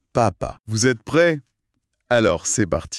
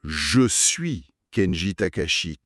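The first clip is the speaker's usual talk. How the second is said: slow, careful je suis Kenji Takashi; teacher spells out je suis Kenji Takashi; slow correct model; slower than usual. Shown as a demonstration. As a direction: model the right sentence slowly. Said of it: "La bonne phrase".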